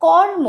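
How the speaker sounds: কর্ম said loud, with a distinct r before the ম; clear r before m. Shown as a demonstration. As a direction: hit the র in কর্ম, then m. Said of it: "ময়ের আগে আমরা রয়ের উচ্চারণ করব".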